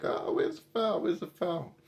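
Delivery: high whiny mock complaint; lightly mocking a whining player. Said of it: "you're like".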